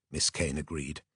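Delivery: plain reading voice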